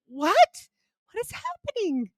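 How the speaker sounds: high, baffled question; squeaky mock confusion; retold bewilderment. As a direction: sound lost and shocked.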